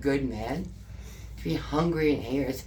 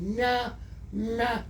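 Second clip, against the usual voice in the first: high-pitched playful butterfly calls; sing-out high, imitating butterfly play; 9 semitones higher than usual. Retelling a hugged man doing the butterfly around her, playful imitation. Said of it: "started doing the butterfly".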